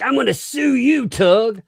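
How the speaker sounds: mock threat. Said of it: "he's like".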